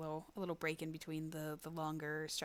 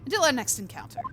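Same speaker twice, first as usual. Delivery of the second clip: loud theatrical farewell; bow out grandly; 7 semitones higher, 11 dB louder than usual. Acted. Character's loud parting line.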